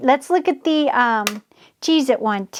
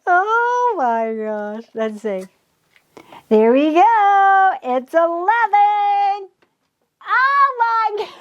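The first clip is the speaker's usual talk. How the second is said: excited giggle; 7 semitones higher, slower than usual. Real, not acted. Crafter seeing the clock set to eleven, thrilled giggle. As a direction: let the thrill spill into laughter. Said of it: "I'm getting way too excited".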